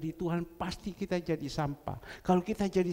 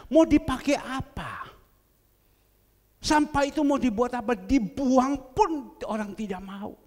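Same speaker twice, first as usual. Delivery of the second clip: high, loud impassioned sermon; preaching at full force; 7 semitones higher, 7 dB louder than usual. Acted. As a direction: hammer home the rubbish image to the church. (khotbah keras dan bernada tinggi)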